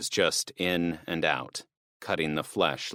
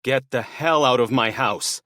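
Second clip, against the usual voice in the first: higher and louder, spitting fury; spat; 6 semitones higher, 5 dB louder than usual. Acted. Father ordering the burglar out of his house, spitting with rage. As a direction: spit the words at the intruder. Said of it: "he spat".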